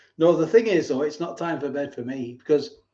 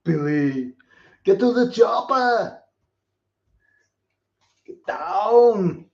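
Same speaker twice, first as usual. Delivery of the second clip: higher, louder Austrian action-hero yell; Arnold Schwarzenegger impression; 5 semitones higher, 5 dB louder, slower than usual. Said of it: "an Arnold impression".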